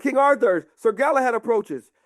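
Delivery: high, proclaimed herald's call; announcing soldier. Acted. Soldiers alerting King Arthur, high heralding call.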